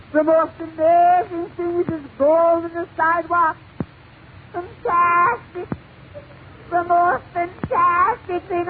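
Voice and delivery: hammed-up awestruck newcomer; amazed Irish immigrant. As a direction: marvel in a high, monotone comic wail. Irish immigrant marvels in joke.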